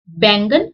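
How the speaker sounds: बैंगन with a nasal n before g; with bindi. Shown as a demonstration. Teacher shows बैंगन with bindi, nasal. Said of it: "दोनों में फर्क पड़ रहा है".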